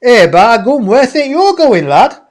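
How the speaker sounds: loud, high, broad Yorkshire; hammed-up Yorkshire questioning, pitched right up; Yorkshire accent. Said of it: "In a Yorkshire accent".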